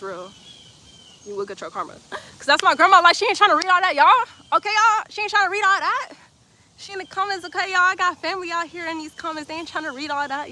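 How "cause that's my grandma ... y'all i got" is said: high, loud insistence; animated protest. Real, not acted. Streamer telling chat grandma is watching, raised voice. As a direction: protect grandma with playful indignation.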